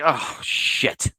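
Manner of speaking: breathy whisper under the breath; hushed oh shit; whispered curse. Retold or imitated. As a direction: whisper it in dismay. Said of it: "And he's like".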